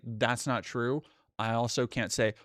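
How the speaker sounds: usual speaking voice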